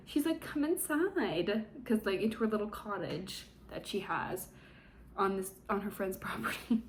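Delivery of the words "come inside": high, slow, drawn-out coo; mock seductive invitation. Imitating Gemma inviting him in.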